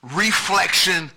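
usual speaking voice

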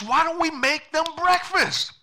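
high, loud, excited suggestion; bursting with a bright idea; eager chicken. Acted. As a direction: pitch the idea like a thrilled hen. Chicken proposes its big idea.

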